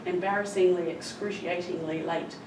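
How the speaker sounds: ordinary talk, nothing marked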